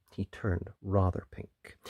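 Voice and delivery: usual speaking voice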